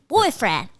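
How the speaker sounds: put-on bungled British accent; British accent attempt. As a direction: try Boyfriend as a posh Brit. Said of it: "in a British accent".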